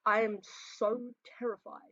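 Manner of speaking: ordinary delivery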